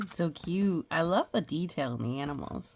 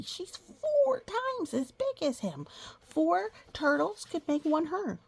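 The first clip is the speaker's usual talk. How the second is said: high amazed exclamation; 10 semitones higher than usual. Real, not acted. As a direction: marvel at how big she is. Marvelling at size.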